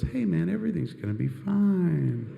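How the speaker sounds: laid-back stoned voice; lightly voiced mellow stoner; stoner inner voice. Weed part of brain speaking.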